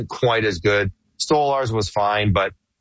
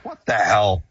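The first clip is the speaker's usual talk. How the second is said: usual speaking voice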